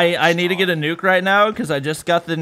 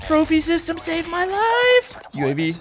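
excited. Real, not acted. Relieved hype after play.

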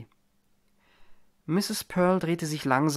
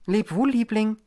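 loud, pitched-up farewell call; 9 semitones higher, 5 dB louder than usual. A narrator's reading. Mary calling goodbye to her husband from the door, loud. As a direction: sing it out to him as you're led away. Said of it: "rief sie".

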